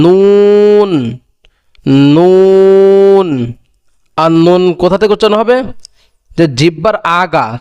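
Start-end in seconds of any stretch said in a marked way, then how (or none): 0.0-3.3 নুন held long and louder; letter demo. Shown as a demonstration. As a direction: stretch নুন clearly. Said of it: "তারপরে কি?".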